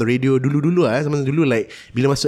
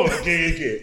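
usual speaking voice

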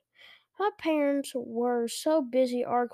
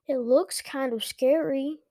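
ordinary delivery, nothing marked

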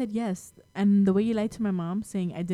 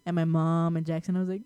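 normal talking voice

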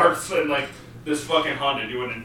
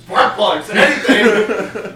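incredulous exclaim; 6 semitones higher, 9 dB louder, much slower than usual. Retold or imitated. Quoting himself arguing with the salesman.

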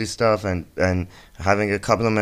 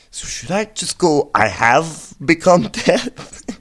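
flustered translator; 7 semitones higher, 3 dB louder, slower than usual. Retold or imitated. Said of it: "this guy's like".

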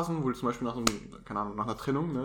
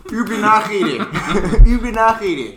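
loud, laughing mock accusation, higher; mock outrage; 8 semitones higher, 16 dB louder, slower than usual. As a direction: shout it like a joking lawyer. (laut lachende, gespielte Empörung)

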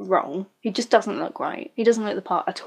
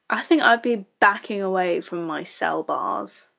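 usual speaking voice

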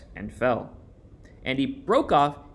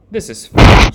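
loud, giddy giggle through the words; giggling; 6 semitones higher, 17 dB louder than usual. A narrator's reading. Martha admiring herself at night, giggling loudly. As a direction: giggle with delight at the mirror. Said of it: "she giggled".

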